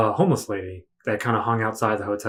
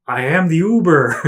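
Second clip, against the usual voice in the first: announcing driver; 6 semitones higher, 6 dB louder than usual. Retold or imitated. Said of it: "he was like".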